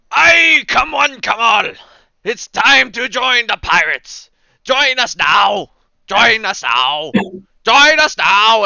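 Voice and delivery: loud, high, drawn-out recruiting call; burly crier shouting. Acted. Burly fella on a milk crate recruiting pirates, shouting. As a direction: holler it slowly to a crowd. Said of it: "He's going".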